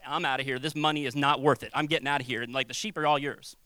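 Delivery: fleeing hired hand. Retold or imitated. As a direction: drop the voice low and shrug it off. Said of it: "they're like".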